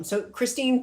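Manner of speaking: usual speaking voice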